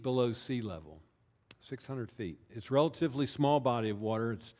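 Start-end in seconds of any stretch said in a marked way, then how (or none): 1.6-2.4 "six hundred feet" echoed much softer, sinking away; soft repeat. Shown as a demonstration. Preacher softly repeating the lake's depth. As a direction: let "six hundred feet" fall to a hushed echo.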